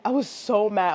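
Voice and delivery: normal talking voice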